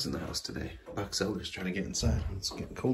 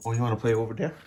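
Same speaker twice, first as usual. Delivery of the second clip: usual speaking voice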